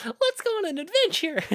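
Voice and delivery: very high, loud squeaky voice; hammed-up kid adventurer; squeaky mock Sora; cartoon hero voice. Kingdom Hearts voices bit.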